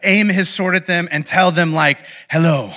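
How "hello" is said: a hint of a raspy avenging swordsman; lightly voiced rasp, lower; understated raspy movie duelist; raspy Inigo Montoya. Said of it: "you gotta do it with the raspy voice".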